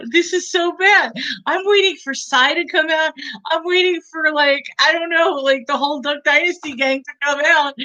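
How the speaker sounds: high-pitched incredulous rant; amused disbelief. Real, not acted. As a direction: sound amazed at how bad it is. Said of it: "amused disbelief at bad movie".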